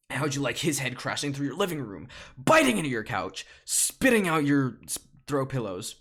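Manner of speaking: loud, building accusation; theatrical rant. Acted. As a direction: punch out each attack verb. Bee rants about the bear.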